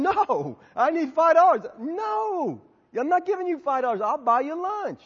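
high, emphatic mock quarrel; acted-out stubborn refusals; insistent arguing. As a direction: argue back and forth, digging in. Acts out argument over five dollars.

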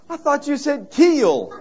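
high, slow Southern drawl on "keel"; a Georgia drawl, stretching "keel"; deep Southern accent. Retold or imitated. Scared stranger at the beach, in a Southern drawl. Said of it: "this deep southern Georgia or Carolinian accent".

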